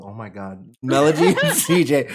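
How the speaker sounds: ordinary delivery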